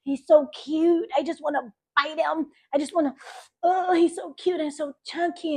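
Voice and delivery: put-on high, doting baby-talk voice; cooing at the baby. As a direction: gush over a chubby baby. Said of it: "And I was like".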